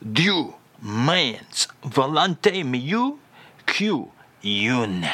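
slow mock French phrase rising on "mieux"; comic French reading, drawn out; fake French phrase. Shown as a demonstration. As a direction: read "Dieu means volonté mieux" slowly with a lift on "mieux". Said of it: "Again, [name], please".

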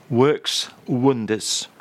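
slow mock-advert endorsement; slow deadpan. [name] praising banana milkshake, slowly. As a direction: drag it out like a cheesy ad.

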